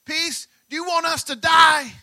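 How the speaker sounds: loud, high, frantic cry; panicked shout. Retold or imitated. Disciples shouting to Jesus in the storm, panicked. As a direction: yell it like a sailor sure he'll drown. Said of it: "That's what they said".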